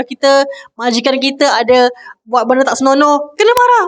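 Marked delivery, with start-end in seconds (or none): 3.3-3.8 emphatic. Real, not acted. Preacher insists on anger.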